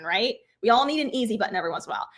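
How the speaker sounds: usual speaking voice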